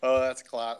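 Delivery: ordinary delivery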